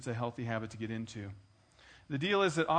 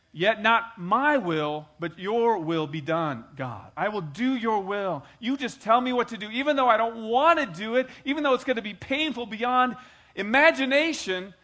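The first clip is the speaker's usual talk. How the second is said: surrendering prayer; 8 semitones higher, 10 dB louder than usual. Acted. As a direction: give in to God's will, crying out. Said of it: "That's what Jesus was saying".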